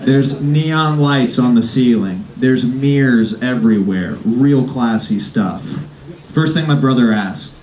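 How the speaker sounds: ordinary talk, nothing marked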